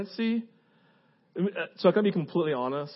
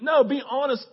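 higher, forceful no, be honest; firm exhortation; 6 semitones higher than usual. Real, not acted. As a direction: insist on it. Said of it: "don't pretend".

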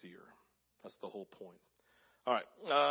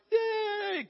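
high, excited whoop; squealing cheer, much higher; mock cheer. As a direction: cheer like a fan at a win. Cheering Elijah's victory.

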